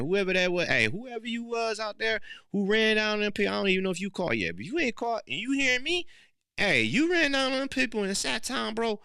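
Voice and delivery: high, loud call to the culprit. Real, not acted. Speaker calling out to the Sacktown shooter, high and loud. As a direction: shout it out to someone far away. Calling out to hit-and-run driver.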